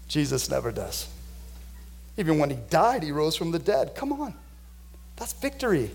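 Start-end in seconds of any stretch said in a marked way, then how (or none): none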